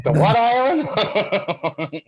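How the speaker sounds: drawn-out, high puzzled question; mock baffled. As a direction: stretch it out in disbelief, way up high. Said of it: "That accent got me right there".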